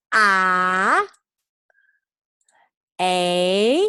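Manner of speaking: each sound said loud and held for repeating; loud held vowel drill. Shown as a demonstration. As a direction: call out each letter sound for the class to echo. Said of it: "repeat with me".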